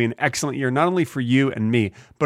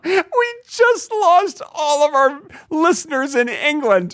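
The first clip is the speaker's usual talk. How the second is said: mock dismay; 12 semitones higher, slower than usual. Mock dismay after bad accents.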